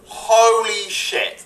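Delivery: high, long drawn-out exclamation; awestruck excitement. Real, not acted. Co-host raving about the Sonic trailer, awestruck excitement. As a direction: stretch it out high, thrilled.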